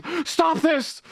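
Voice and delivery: high, drawn-out mock plea; desperate pleading.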